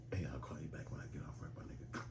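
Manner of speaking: hushed whisper, ducking the boss. Retold or imitated. Worker ending call at work, quick hushed whisper.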